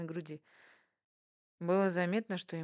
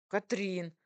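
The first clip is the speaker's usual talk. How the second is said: mildly raised exclamation of a name; exclaimed reproach; 3 semitones higher, 4 dB louder than usual. A narrator's reading. Husband protests at wife's teasing, exclaiming. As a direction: call her name in protest.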